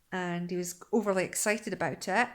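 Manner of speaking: normal talking voice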